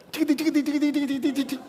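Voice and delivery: chiquiti agudo y ligero, imitando el golpe; sonido de varazos. Retold or imitated. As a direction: canturrea el zumbido de la vara, muy agudo. Imita el sonido de los palitos. (imitando los golpes de la vara de tamarindo, agudo)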